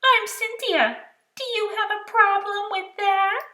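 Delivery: high, loud challenge; indignant. Acted. Girl monster Cynthia challenging the boy, high and loud. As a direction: dare him to object.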